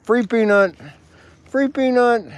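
high, loud sing-song call. Real, not acted. Man calling squirrels over with peanuts, high and loud. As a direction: lure them in with a bright high call.

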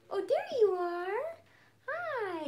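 high, slow fond hello; tender greeting to the cat. Real, not acted. Finds the cat.

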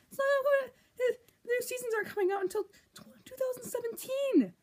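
high, cracking despairing wail; anguished wail. Real, not acted. Vlogger wailing to camera about the long wait, voice cracking.

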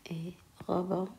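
murmuré lentement, mot après mot, en écrivant au tableau; à voix basse en écrivant. Real, not acted. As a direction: dicte tout bas pour soi, avec de longues pauses. Dictates softly while writing on board. (la maîtresse écrit au tableau et se dicte les mots, à voix basse)

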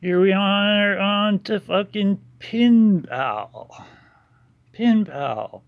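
sarcastic. Real, not acted. Mocking misspelling.

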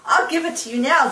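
mock excited.

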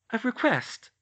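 put-on high, loud girl voice, statement falling; teasing reveal. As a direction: lay it out plainly as a surprise. Hermione answers, George echoes.

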